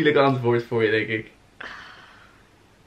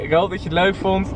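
ordinary talk

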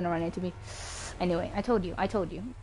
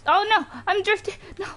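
panicked outcry; 11 semitones higher than usual. Real, not acted. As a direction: call out in panic as the car slips. Losing control in the race.

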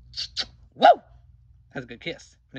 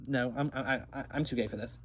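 ordinary talk, nothing marked

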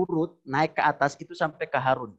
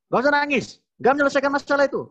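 high, louder, rushed scolding; dismissive husband; 10 semitones higher, 5 dB louder, much faster than usual. Acted. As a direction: imitate an impatient husband brushing off tears. Imitates husband dismissing crying wife. (meniru suami yang ketus, nada tinggi)

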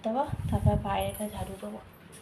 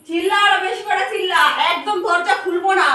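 loud, higher scolding yell; angry shout; 8 semitones higher, 15 dB louder than usual. Real, not acted. Woman yelling back at someone shouting outside, angry. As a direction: shout back to shut them up.

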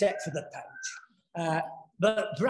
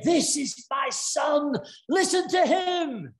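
higher, slow, proclaiming; voice of God; 7 semitones higher, slower than usual. Acted. Preacher voices the Father speaking from the cloud, slow and proclaiming. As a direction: ring it out like a voice from heaven.

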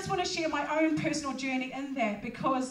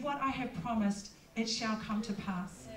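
solemn voice of God; 5 semitones lower, 6 dB quieter than usual. Retold or imitated. God's voice quoted, low and slow.

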